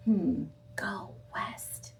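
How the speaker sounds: slow, soft, whispered musing; thinking aloud. Acted. Camilla pondering the order in a slow whisper. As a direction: whisper it as you puzzle.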